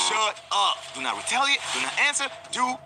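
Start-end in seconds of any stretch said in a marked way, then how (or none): none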